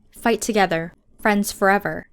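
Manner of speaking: usual speaking voice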